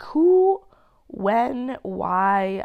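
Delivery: high, loud incredulous who; exasperated. Real, not acted. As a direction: throw the question up in disbelief. Exasperated self-questioning.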